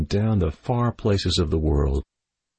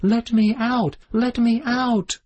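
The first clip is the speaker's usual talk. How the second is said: high, pleading little bird cry; the caged bird begs to get out, high and desperate; bird crying to be let out; slower than usual. A narrator's reading. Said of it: "he began to cry again".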